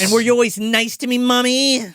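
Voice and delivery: high, loud creepy little-son voice; needling child. Acted.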